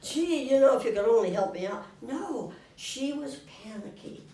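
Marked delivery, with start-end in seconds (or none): 0.0-1.8 loud, fast wheedling plea; mock a needy person begging; wheedling ask. Mocking how some people beg for help, loud and fast wheedling. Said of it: "how some people say".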